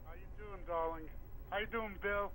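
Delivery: faint voice far from the phone; phone held away. Real, not acted. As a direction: sound distant from the receiver. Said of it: "Talk, talk into the phone a little so we can hear you".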